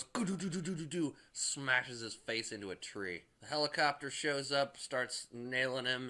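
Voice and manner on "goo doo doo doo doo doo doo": higher, silly warbling gibberish; mock the Hulk's dopey babble; goofy Hulk noise. Retelling the Hulk's kick, a goofy higher warble. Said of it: "and he goes".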